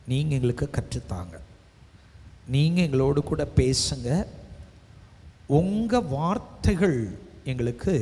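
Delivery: loud, emphatic congregational prayer; fervent prayer. Real, not acted. Preacher asking God to speak to the congregation, fervent prayer. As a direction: pray out strongly, drawing out the key words.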